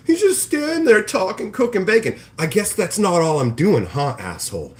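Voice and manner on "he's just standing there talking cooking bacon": higher scoffing mock voice; mock scoffing friend. Streamer mocking jealous friends belittling his stream, scoffing mock voice. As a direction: sneer it dismissively.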